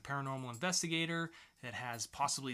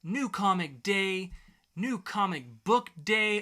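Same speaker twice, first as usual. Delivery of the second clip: slow, loud, higher announcer voice; announcer intro; 5 semitones higher, 8 dB louder, slower than usual. Acted. Host opening the weekly poll segment, big slow announcer voice. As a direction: draw each phrase out like a jingle.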